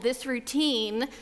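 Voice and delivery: ordinary talk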